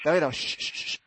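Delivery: soft shushing, nearly breathless; hushing. Retold or imitated. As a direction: hush them gently like sheep. Hushing, patting his back. (轻声嘘着安抚)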